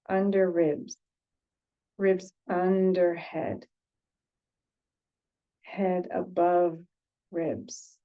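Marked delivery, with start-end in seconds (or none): none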